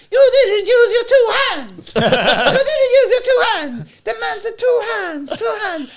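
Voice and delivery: put-on high, slow scolding old Italian lady; squeaky high mock nonna scolding. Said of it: "an Italian lady... She was like".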